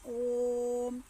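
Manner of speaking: "ओम" with the vowel held very long on one steady level pitch; pluta vowel. Shown as a demonstration. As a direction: chant "ओम", holding the O about three times as long as normal. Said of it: "जैसे इनके उदाहरण क्या है?". ("ओम" को तीन गुना लंबा, एक सुर में खींचकर)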